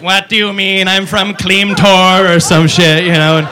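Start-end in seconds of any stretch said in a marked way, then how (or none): 0.0-2.4 very slow robotic alien voice. Retold or imitated. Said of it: "the alien will be like".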